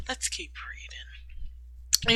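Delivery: slow soft whisper. Real, not acted. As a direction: whisper it slowly. Reader whispers to move on.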